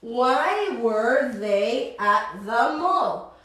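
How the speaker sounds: slow, careful question, every word clear; slow teaching read. Shown as a demonstration. Teacher reads the question slowly for Spanish-speaking students, slow. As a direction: say it slowly for learners. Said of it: "Cuando lo digo lento así".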